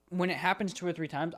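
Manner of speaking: usual speaking voice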